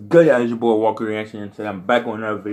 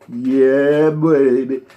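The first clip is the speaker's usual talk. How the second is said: a loud, higher hype shout; excited cheer; 4 semitones higher, 6 dB louder, much slower than usual. Real, not acted. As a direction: cheer it like you just won. Celebrating imagined birthday milestone.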